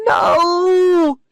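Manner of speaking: high loud cry of horror; howling shout of no; anguished cry. A narrator's reading. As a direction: scream it in despair. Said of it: "Daniel cried".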